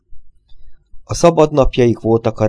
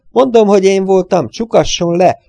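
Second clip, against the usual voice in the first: much higher, louder insisting cry; desperate insistence; 11 semitones higher, 6 dB louder than usual. A narrator's reading. The little man begs Gregory to arrest him, much higher and louder. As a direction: beg to be locked up.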